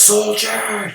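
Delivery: high-pitched summoning shout; drunken general's shout. Retold or imitated. Drunk general shouts for his soldier to prove bravery, shouted high. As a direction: bellow for a soldier like a tipsy general. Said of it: "like the general shouts, you know, drunken general".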